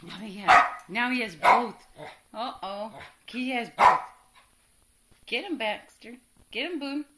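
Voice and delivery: loud and thrilled; excited shout. Real, not acted. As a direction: call it out, amazed. Excited at cat taking balls.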